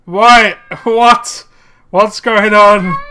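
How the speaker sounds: high, loud laughing confusion; loud disbelieving questions; baffled. Real, not acted.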